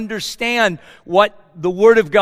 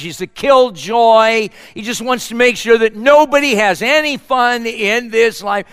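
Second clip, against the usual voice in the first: raised, driving preacher voice; sermon at full force; preaching; 5 semitones higher, 4 dB louder than usual. Acted. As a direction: preach it hard to the congregation. Rhetorical questions, raised voice.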